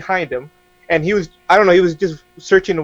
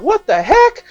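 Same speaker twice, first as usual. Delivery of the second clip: very high, baffled exclamation; mock bewilderment; slower than usual. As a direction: shoot the pitch up in comic disbelief. Retells shock at empty kitchen.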